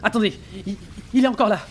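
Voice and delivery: voix aiguë, haletante, affolée; affolé. Acted. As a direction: bafouille de panique, vite. Matelot paniqué après la vision. (le matelot, paniqué, parle au capitaine)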